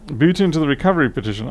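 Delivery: reading the chat aloud. Retold or imitated. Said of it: "Oh yeah, right".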